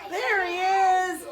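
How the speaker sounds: high, loud, slow "there he is"; showing the card to the class; delighted reveal. Real, not acted. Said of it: "Who's this?".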